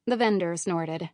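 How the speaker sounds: ordinary delivery, nothing marked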